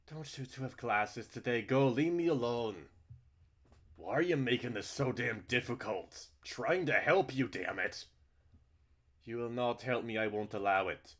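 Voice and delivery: loud, high exasperated yelling; a furious family argument; angry shouting. Acted. Characters shouting at each other.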